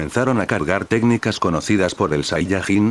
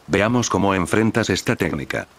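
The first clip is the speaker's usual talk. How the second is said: usual speaking voice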